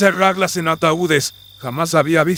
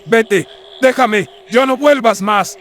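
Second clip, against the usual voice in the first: higher-pitched, frightened commands; desperate banishing; 4 semitones higher than usual. Acted. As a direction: drive the apparition away in panic. Friar drives away the apparition. (echarlo con desesperación, en tono agudo)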